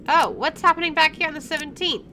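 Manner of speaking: ordinary talk, nothing marked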